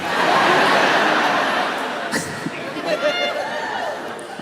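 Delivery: long high wheezing laugh; breathless cackle; big laugh. Real, not acted. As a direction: dissolve into laughter. Cracks up after bit.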